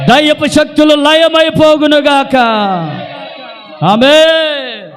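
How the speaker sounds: rising to a climax, ending on a long amen; fervent preaching prayer. Acted. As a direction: proclaim each blessing like a revival preacher. Prayer at full force.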